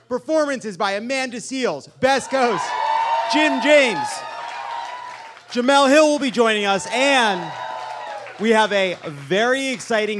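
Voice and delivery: high, loud shout over cheers; hype announcement. Real, not acted. Host announces the performers to a cheering audience, hyped shouting. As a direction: belt out each act for the crowd. Hypes guests to cheering crowd.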